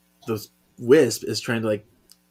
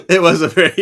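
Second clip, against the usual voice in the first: laughing; 8 semitones higher, 8 dB louder, faster than usual. Real, not acted. Both laughing at the line.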